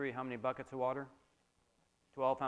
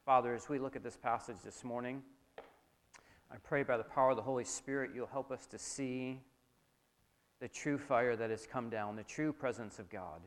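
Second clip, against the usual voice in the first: public prayer; 6 dB quieter than usual. Real, not acted. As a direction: address God quietly and reverently. Leads congregation in prayer.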